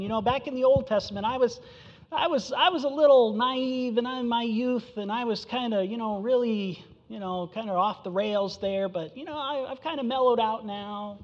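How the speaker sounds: a hint of a sheepish old-timer looking back; mellowed God. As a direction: lightly voice a laid-back old man. Voicing God as mellowed old man.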